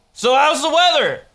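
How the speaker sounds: high, loud sing-song topic change; breezy fake innocence; mock innocent. Abrupt subject change.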